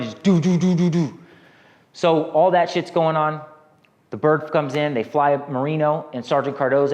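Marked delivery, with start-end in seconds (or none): 0.1-1.2 1 mock gunfire. Imitating machine gun fire.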